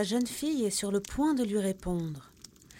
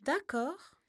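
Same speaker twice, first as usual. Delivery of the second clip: put-on high young girl's voice, curious; intrigued girl; 9 semitones higher than usual. Margot agrees, curious, high girl's voice. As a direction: agree with a puzzled lift. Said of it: "dit la jeune fille, intriguée".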